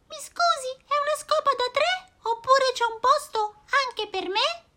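molto acuta, cinguettante; vocina di uccellino. Acted. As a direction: chiedi gentile come un uccellino. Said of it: "Poi dice". (uccellino che chiede un posto, vocina altissima)

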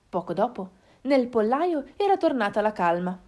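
ordinary delivery

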